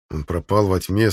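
ordinary delivery

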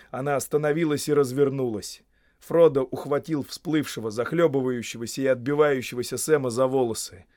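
ordinary delivery, nothing marked

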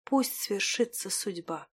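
slow, faint resignation; frail, hushed acceptance; weak voice. A narrator's reading. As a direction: let it fade softly. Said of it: "слабым голосом произнесла она".

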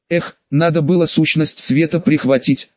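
ordinary delivery, nothing marked

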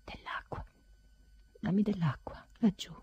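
faint, barely voiced plea; a man after a crying fit murmuring weakly for water. A narrator's reading. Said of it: "mormoravo con voce debole".

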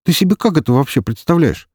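high-pitched, exasperated protest; affronted, incredulous question; indignant. A narrator's reading. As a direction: push back at an unreasonable demand. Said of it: "возмутился Шабин".

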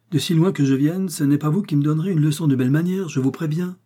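angry retort. A narrator's reading. D'Artagnan angrily warning Athos on the stairs. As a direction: fire back the retort in a rush. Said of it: "il s'arrêta court".

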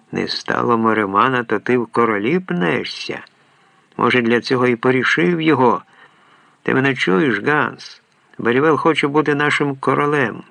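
plain reading voice

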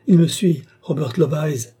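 fleeing narrator warns Mrs Loveyes; panicked warning. A narrator's reading. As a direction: blurt it out in fear.

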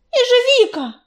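yelling a name. A narrator's reading. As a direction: shout it out to him. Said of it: "завопила Белочка".

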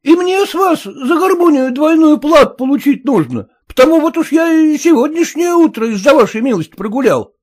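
high peasant plea to the master; blacksmith calling out. Acted. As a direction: call up high to the master for double pay. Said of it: "кричал кузнец Калистрат".

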